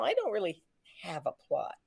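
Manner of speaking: soft, trailing admission; sheepish confession. Retold or imitated. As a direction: confess it quietly. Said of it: "go to Bob and say".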